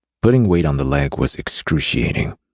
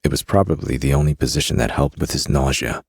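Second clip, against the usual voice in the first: ordinary delivery, nothing marked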